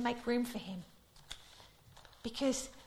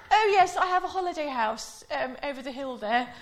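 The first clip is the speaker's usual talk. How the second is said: 1 Queen; 2 semitones higher, 7 dB louder than usual. Retold or imitated. Queen answering tourists.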